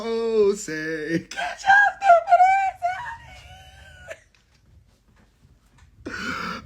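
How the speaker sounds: very high screaming mock threat; mock shrieking gangster. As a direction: shriek it like Chopper. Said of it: "Chopper talking about".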